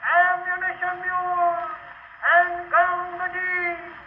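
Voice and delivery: high, slow drawn-out soldier's shout; battlefield shout. Acted. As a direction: call it across the battlefield, slow. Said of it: "you could hear the front file shout".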